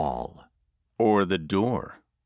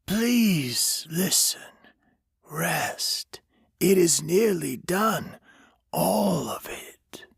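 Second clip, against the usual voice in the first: whispering stranger; 9 semitones higher, slower than usual. A narrator's reading. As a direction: breathe it into his ear. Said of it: "the stranger said".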